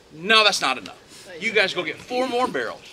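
forceful, booming refusal; insistent command. Acted. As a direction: send them back emphatically. Elijah insists again, acted.

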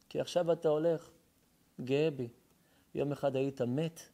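ordinary talk, nothing marked

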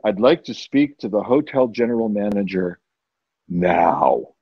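low, controlled ultimatum; deep, no-nonsense guest at the desk; stern demand. Retold or imitated. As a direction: drop the pitch and demand it firmly. Second demand at front desk.

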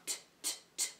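whispered, breathy t t t sounds; letter T sound. Shown as a demonstration. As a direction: tap out the T sound softly like a teacher. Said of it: "T for turtle".

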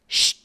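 short, cutting "shh"; sharp shush. A narrator's reading. Giver interrupting Jonas's question, sharp shush. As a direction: cut someone off with a quick hush. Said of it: "the giver said sharply".

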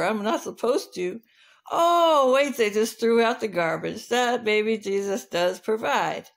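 punchy dog voice with a big "Oh"; hungry dog. Acted. Dog tempted by raw rooster, then spotting garbage, eager. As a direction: confess as a good-hearted, hungry dog. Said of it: "The dog said".

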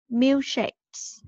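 Milkshakes joined up as one word. Shown as a demonstration. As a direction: run Milkshakes together without a pause. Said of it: "mình đọc nhanh hơn một chút nha".

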